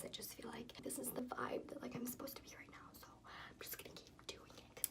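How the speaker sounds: whispery voice. Real, not acted. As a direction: keep the hushed spa whisper going. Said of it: "like, a whispery voice".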